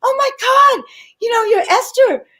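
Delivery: high, thrilled recognition of a star; excited fan. Retold or imitated. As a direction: gush like a starstruck passenger. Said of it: "and this woman says".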